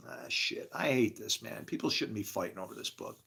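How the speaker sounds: very quiet, low, deflated; weary dismay. Acted. Lamenting that people fight over the book, dejected. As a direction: let it sink away under the breath.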